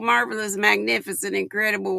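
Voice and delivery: ordinary talk, nothing marked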